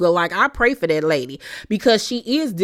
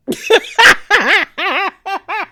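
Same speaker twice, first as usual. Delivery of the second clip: real high laugh; 11 semitones higher, 4 dB louder than usual. Real, not acted. Laughs at the scene.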